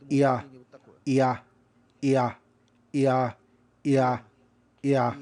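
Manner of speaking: IA starting high, dropping on the A; high-to-low glide. Shown as a demonstration. Teacher recording a pitch exercise sample, IA falling high to low. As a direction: punch IA, falling from E to A. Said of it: "We have high E and low R".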